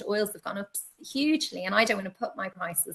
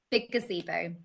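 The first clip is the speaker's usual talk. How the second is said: ordinary talk, nothing marked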